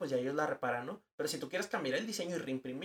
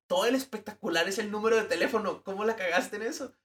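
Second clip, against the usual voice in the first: higher-pitched, incredulous telling-off; mock scolding; 6 semitones higher, 5 dB louder than usual. Designer scolding over the botched phone number, incredulous reproach.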